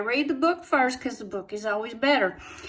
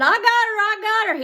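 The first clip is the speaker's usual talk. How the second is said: high, loud triumphant yell; daddy yelling from up the tree, excited; 11 semitones higher, 5 dB louder than usual. Retold or imitated. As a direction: whoop it down from the treetop. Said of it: "he commenced to yelling".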